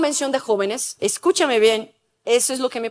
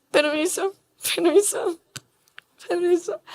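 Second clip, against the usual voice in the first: soft, shy permiso repeated; timid murmured requests; 3 semitones higher, 6 dB quieter than usual. Retold or imitated. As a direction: slip past politely, barely audible. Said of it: "Cerré mis ojos".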